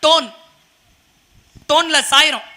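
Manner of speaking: normal talking voice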